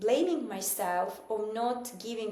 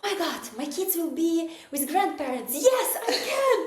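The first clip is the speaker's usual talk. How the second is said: excited; 8 semitones higher, 6 dB louder than usual. Retold or imitated. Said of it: "And I was like".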